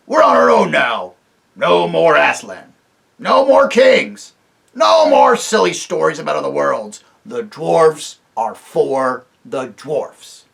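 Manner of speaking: loud, slow chanting defiance; defiant dwarfs. Acted. The dwarfs reject kings and Aslan, loud and defiant. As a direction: proclaim it as a rule.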